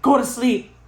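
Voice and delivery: sudden loud, high command; retold startling yell. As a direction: imitate grandma's sudden yell. Grandma suddenly startles her in retelling.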